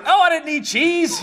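high, mock-embarrassed "oh, I didn't need cheese"; a husband caught out, hammed up a little; sheepish realization. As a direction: catch yourself, lightly sheepish. Husband realizes his blunder.

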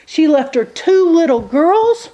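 lightly voiced lawyer telling the shocking part; lawyer confiding. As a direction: reveal the scandal bit by bit. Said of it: "She goes".

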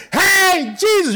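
high, loud repeated call; shouted call to Jesus, a bit lighter. Retold or imitated. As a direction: cry out his name again. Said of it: "That's what he said".

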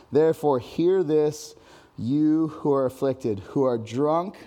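loud, high-pitched proclamation of wrath; forceful scripture reading. Acted. Preacher to the congregation, reading God's wrath in a booming voice. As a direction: thunder God's warning over the room.

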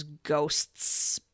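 usual speaking voice

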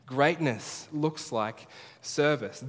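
Greatness looks like service, each word drawn out very slowly; very slow, deliberate refrain; very slow emphatic. Shown as a demonstration. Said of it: "that's the big idea".

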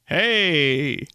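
drawn-out, fake friendly greeting; put-on polite voice. Forced to greet whoever rang, put-on polite voice. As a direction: put on your answering-the-door voice. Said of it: "actual real person voice".

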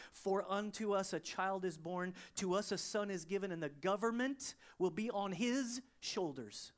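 loud, emphatic proclamation of prophecy; triumphant scripture preaching. Acted. Preacher reading Isaiah's prophecy to the congregation, triumphant proclamation. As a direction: declaim it with force, phrase by phrase. Reads prophecy at full force.

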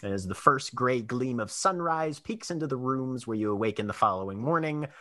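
normal talking voice

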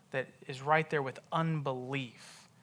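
ordinary talk

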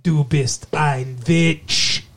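slow mock German accent; hammy German accent, drawn out. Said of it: "Can you do a German accent?".

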